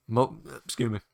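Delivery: burp mid-word; talking through a burp. Real, not acted. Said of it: "I'm burping".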